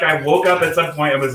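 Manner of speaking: ordinary talk, nothing marked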